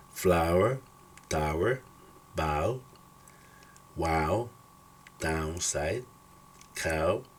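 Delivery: "cow", "how", "down" with the OW of "now"; English OW as in cow. Shown as a demonstration. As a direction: model "power", "flower", "crown" for the class. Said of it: "acá se pronuncia como ow".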